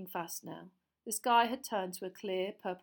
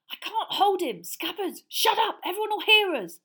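panicked; 12 semitones higher, 11 dB louder than usual. Acted. Ron panicking with rat.

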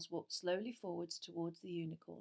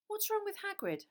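put-on worried boy; 13 semitones higher, 3 dB louder than usual. A narrator's reading. Harry worried, hurrying.

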